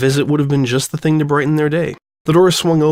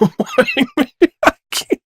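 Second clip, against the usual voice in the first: louder giggly giving up; breaking up laughing; 12 semitones higher, 4 dB louder than usual. Real, not acted. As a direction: laugh through it, can't stay serious. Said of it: "I can't take this seriously".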